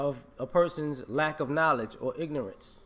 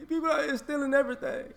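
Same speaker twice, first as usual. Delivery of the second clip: high, mocking whine; mock complaining thief; 10 semitones higher than usual. Mocking thieves who got robbed back, in a high whine. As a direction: sneer it like a whiny thief.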